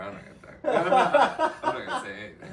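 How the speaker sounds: high, giggling refusal; laughing. Real, not acted. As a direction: squeak it out through giggles.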